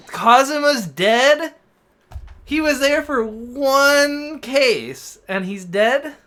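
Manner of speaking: loud, high, drawn-out disbelief; stretched words, loud incredulous protest; shocked outburst. Real, not acted. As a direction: cry out in outraged disbelief at the news. Reacting to a character's death.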